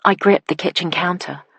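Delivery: usual speaking voice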